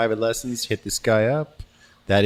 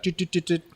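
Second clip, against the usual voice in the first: imitated streaming beeps; 8 semitones higher, 4 dB quieter than usual. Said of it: "And she's got, like".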